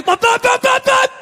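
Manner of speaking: high punchy bop sounds; car gear noises. Retold or imitated. Dad speeding off down the driveway, imitated engine noise. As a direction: mimic the car tearing off.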